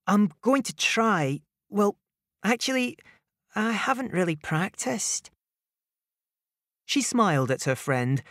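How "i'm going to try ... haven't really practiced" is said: high, bashful voice; shy robot ninja. Acted. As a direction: mumble it shyly, looking down. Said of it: "He looked down at the ground as he said".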